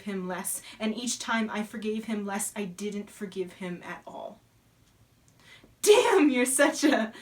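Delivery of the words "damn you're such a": loud, higher, drawn-out curse; raised-voice exclamation, cut off midway; acted outburst. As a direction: burst out loudly, stretching the words.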